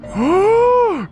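sharp high-pitched gasp; excited gasp. Real, not acted. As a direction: gasp at another prize. Gets another prize.

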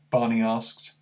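ordinary delivery

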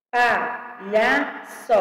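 slow "Palhaço", pa-lha-ço in separate beats; syllable by syllable. Shown as a demonstration. Teacher separating words for pupils, syllable by syllable. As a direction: sound out each syllable of "Palhaço". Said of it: "separação de palavras e sílabas".

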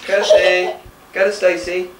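calling out. Real, not acted. Parent calling baby across room.

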